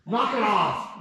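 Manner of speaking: a wife fed up with her husband's antics, sharp and loud; annoyed snap. Acted. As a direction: snap at him to quit it.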